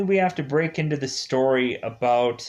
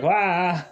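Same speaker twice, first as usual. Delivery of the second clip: mock scared yelp; 6 semitones higher, 4 dB louder than usual. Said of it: "And the turtles are like".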